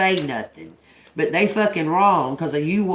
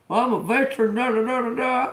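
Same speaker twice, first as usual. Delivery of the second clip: higher mock blustering veteran; imitated old man; 6 semitones higher, faster than usual. Old man defending himself to driver, mocking imitation. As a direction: mimic his excuse, tailing into dismissive filler. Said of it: "That old man was like".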